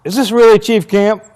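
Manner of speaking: high, loud, incredulous question; doubting it's a prank. Retold or imitated. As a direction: ask in disbelief whether it's really him. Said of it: "And I go".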